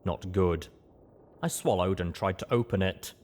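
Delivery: plain reading voice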